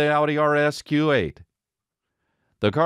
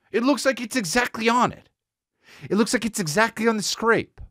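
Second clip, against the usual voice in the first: high indignant insistence; 9 semitones higher than usual. Real, not acted. Indignant discovery.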